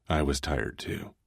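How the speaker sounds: ordinary delivery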